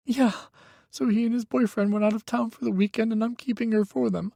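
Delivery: put-on high, tense young voice; a frightened young man pleading on the phone; young man, scared. A narrator's reading. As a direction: Micah telling Hawk his ex is dangerous, high and scared. Micah's voice.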